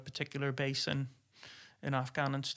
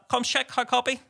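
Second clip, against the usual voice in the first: higher, louder breezy radio check; casual radio call; 10 semitones higher, 6 dB louder than usual. Retold or imitated. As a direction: toss off a relaxed comms check. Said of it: "you'd be like".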